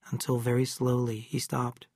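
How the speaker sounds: ordinary delivery, nothing marked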